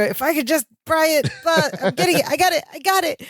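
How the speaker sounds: high, imitated eager struggle; Larry. Larry prying inside the chimney, high imitated eagerness. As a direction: mimic a man working at something, pitched well up throughout. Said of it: "you just hear Larry go".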